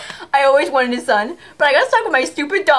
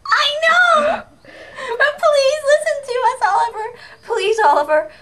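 desperate pleading; 9 semitones higher than usual. Acted.

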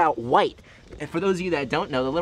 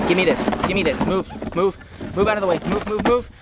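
snatch it and clear them off; high firm move-move shooing; urgent shooing; 5 semitones higher than usual. Real, not acted. Grabbing baby from otters.

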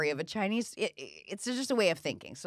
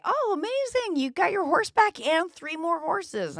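much higher and a bit louder, gushing amazement; excited neighbors; 11 semitones higher, 3 dB louder than usual. Retold or imitated. Neighbors congratulating the farmer on his horses, high excited gush. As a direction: play the thrilled neighbor celebrating the horses. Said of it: "And the neighbors say".

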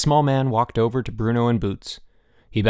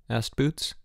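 ordinary delivery, nothing marked

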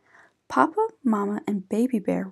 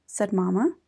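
ordinary delivery, nothing marked

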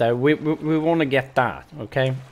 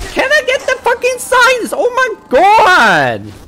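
very high, loud, frustrated scream; exasperated yelling; 10 dB louder than usual. Real, not acted. Player desperate to get the signs, exasperated yelling. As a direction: yell it high, stretching the final word. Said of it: "Sorry for yelling".

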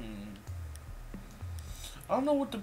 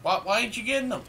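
frustrated yell; 7 semitones higher, 4 dB louder, faster than usual. Real, not acted. Teammate not shooting.

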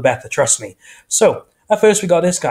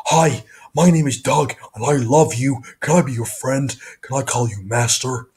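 slow, eager talking dog voice; an adoring cartoon dog begging to be friends; Doug the dog; slower than usual. Shown as a demonstration. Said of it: "I do a pretty accurate impression of him".

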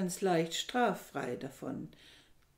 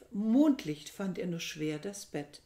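ordinary delivery

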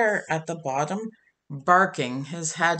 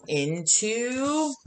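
drawn-out into; 3 semitones higher than usual. A narrator's reading. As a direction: stretch "into" long and rising, like a cliffhanger. Suspense before page turn.

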